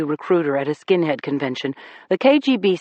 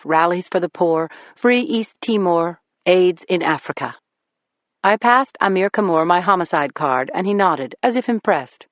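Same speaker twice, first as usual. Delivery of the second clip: ordinary delivery, nothing marked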